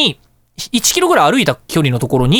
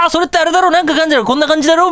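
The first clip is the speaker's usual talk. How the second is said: higher, a light imitation of a guessing old man; know-it-all old shopkeeper; 9 semitones higher, faster than usual. Old shop owner bluffing about model kits to a young customer, lightly imitated know-it-all. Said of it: "さも知ってるかのような感じで".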